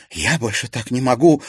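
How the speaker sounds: blurted out. A narrator's reading. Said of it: "выпалила Серафима". (выпалить громко)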